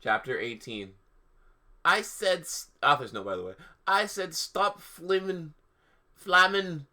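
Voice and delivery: hammed-up reading of bad fan fiction; mock-earnest story narration; mock dramatic reading. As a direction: read it like a deadpan goth diary. Reads the fan fiction aloud.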